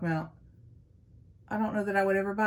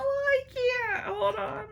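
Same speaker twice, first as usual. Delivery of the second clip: laughing too hard; much slower than usual. Real, not acted. Laughing fit, can't go on.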